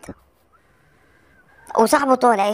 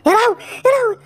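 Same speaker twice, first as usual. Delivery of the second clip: frantic panic, voice shooting much higher and louder; panicked fright; 12 semitones higher, 3 dB louder than usual. Real, not acted. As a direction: shriek in terror as he pops up in your face. Enemy suddenly in his face. (فزع ورعب بصوت عالٍ جداً)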